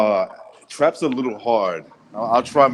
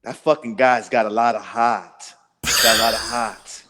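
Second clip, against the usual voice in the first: put-on Boston accent; 3 semitones lower than usual. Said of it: "the Boston accent".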